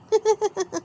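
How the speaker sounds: high mocking giggle; a quick high chuckle; Mummy Pig laughing at Daddy, high giggle.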